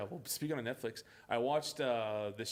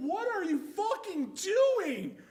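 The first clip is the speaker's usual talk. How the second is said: slow, high, loud disbelief; exasperated outburst; 13 semitones higher, 5 dB louder, slower than usual. Acted. Yelling back at the actress, exasperated. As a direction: berate the actor, drawing it out. Exasperated reaction to bad acting.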